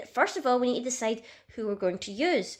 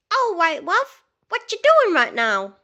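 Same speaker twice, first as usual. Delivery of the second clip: much higher and louder, slow, a cheery put-on Tracer voice; bad Tracer impression; 8 semitones higher, 7 dB louder, slower than usual. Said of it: "my really bad impression of doing an, uh, Tracer impression".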